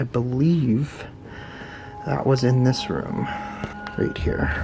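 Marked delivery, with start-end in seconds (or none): 4.0-4.5 breathy hushed whisper; nervous night vlog whisper. Real, not acted. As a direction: whisper it at the murder spot. Whisper at murder room.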